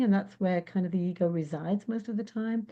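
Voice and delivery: ordinary talk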